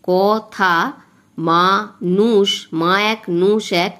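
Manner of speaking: very slow, each syllable of the line drawn out; teacher counting the beats of the verse slowly for students. Shown as a demonstration. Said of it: "এইভাবে আমি মাত্রাগুলো দিয়ে ফেলেছি".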